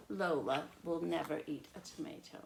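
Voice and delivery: usual speaking voice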